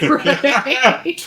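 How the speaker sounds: ordinary talk, nothing marked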